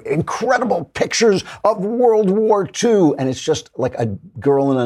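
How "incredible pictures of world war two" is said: mocking clickbait. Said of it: "they say".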